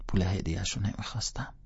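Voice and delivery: ordinary delivery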